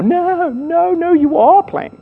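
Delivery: high-pitched, comic frantic refusal; hammed-up high-voiced pleading; mock panicked protest. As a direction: squeal in denial as a desperate coach. Acted coach refusing player's quitting.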